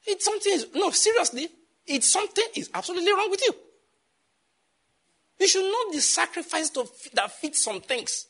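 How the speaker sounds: real high-pitched exasperation; getting angry. Real, not acted. Pastor scolding those who sacrifice wrongly, worked-up anger. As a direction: voice jumps high in indignant scolding. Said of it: "I'm getting angry like this".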